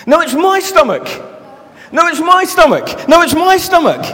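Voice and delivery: a hint of bickering crowd; squabbling voices. Voices arguing over rumbling stomachs.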